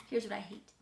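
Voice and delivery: usual speaking voice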